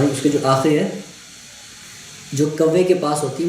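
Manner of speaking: ordinary talk, nothing marked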